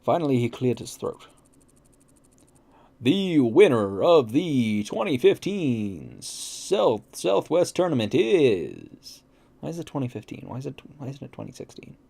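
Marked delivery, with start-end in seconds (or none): 3.0-9.2 slow, drawn-out announcer voice; announcer build-up. Acted. MC announces the tournament winner, slow suspenseful announcer. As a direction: stretch each word to build suspense.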